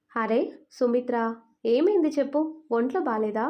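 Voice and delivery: usual speaking voice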